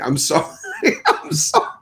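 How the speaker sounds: ordinary delivery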